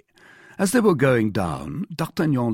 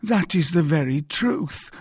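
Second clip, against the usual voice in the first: high-pitched, anxious confirmation; crying out; 9 semitones higher, 4 dB louder than usual. A narrator's reading. Mercer answers D'Artagnan's demand, crying out. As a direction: blurt it out in a raised pitch. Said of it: "cried the mercer".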